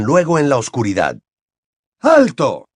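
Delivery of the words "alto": high, loud cry to stop; shouted command. A narrator's reading. Father stopping wizards firing at his son, shouting. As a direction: call out to halt the attack. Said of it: "gritó una voz familiar".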